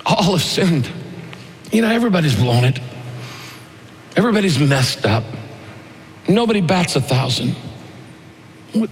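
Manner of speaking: gentle, understated sorrow, no shouting; broken-hearted, matter-of-fact. Shown as a demonstration. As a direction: admit it plainly with a heavy heart. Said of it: "say it with a broken heart".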